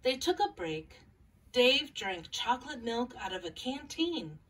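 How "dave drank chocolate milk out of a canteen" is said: usual speaking voice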